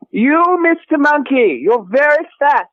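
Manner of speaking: high, slow taunting; sing-song teasing at a monkey; mock taunting.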